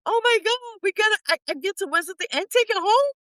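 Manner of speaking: high, bright rush of excitement; gushing about finally winning something; excited squeal. Real, not acted. To the interviewer, gushing over her first win, high excitement.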